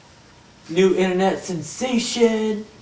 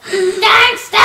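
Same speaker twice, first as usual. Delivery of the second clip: high, loud yell; shouted catchphrase; 13 semitones higher, 8 dB louder than usual. Acted. Kid yelling a catchphrase for the camera, high and loud. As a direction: bark it like a hype man.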